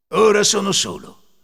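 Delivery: loud, high self-satisfied thief; thief's voice. A narrator's reading. Thief left alone with the money, loud satisfaction. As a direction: declare it to yourself aloud. Ladro parla tra sé.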